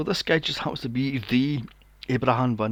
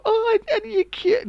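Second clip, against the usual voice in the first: very high, loud squealing coo; hammed-up high-pitched aunty voice; gushing aunty; 6 dB louder than usual. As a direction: coo over a child like an overexcited aunty. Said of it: "They'd grab you and go".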